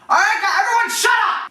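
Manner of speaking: high-pitched shout to hush a crowd; acted yell. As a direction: yell over the team for silence. Acted yelling to hush the team.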